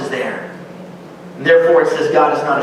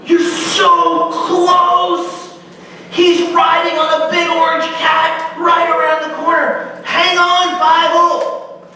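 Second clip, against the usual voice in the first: audience shouting; 12 semitones higher, much slower than usual. Acted. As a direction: yell at the screen so he won't quit. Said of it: "everything in you goes".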